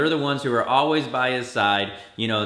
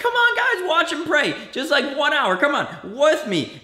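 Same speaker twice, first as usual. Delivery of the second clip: much higher, louder pleading; exasperated plea; 13 semitones higher, 4 dB louder than usual. Retold or imitated. Jesus pleading with sleeping disciples, high exasperated plea. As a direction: beg the sleepy friends to stay up, way up high. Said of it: "Jesus was like".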